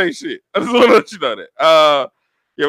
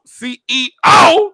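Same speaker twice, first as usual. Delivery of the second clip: slow, drawn-out hype shout, higher; 12 semitones higher, 7 dB louder, much slower than usual. Acted. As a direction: call out the title like an announcer. Hyping co-host title.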